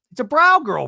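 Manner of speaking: high, loud indignant cry; outraged outburst. Retold or imitated. Brittany in talking head, indignant outburst. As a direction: blurt it in disbelief.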